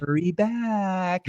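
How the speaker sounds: drawn-out eerie theme-park farewell; Haunted Mansion ghost voice. Retold or imitated. Host mocking the ride's ending voice, slow eerie ghost. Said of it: "the voice at the end of the Haunted Mansion".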